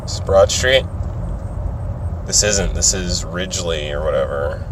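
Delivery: soft, low mumble; quiet, low thinking aloud; muttering to self. Real, not acted. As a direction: mutter under your breath while checking the road. Muttering to self over directions.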